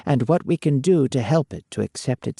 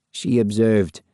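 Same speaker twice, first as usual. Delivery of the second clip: ordinary delivery, nothing marked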